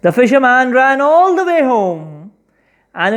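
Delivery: ordinary delivery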